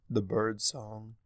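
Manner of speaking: usual speaking voice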